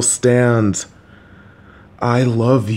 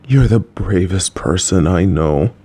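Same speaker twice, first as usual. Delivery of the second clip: ordinary talk, nothing marked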